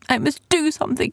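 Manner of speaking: high, hurried worried thought; quick, high-pitched desperation; anxious resolve. Acted. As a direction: urge yourself on while frightened. Frightened hero urging himself in shadows.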